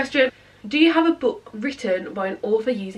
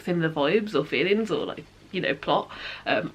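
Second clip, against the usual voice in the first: ordinary talk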